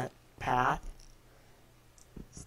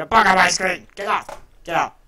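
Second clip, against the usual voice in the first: loud panicked yelling; freaking out; 4 semitones higher, 13 dB louder than usual. Retold or imitated. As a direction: shout at the bug in alarm. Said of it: "I was freaking out".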